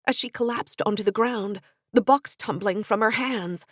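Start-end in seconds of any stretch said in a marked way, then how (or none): none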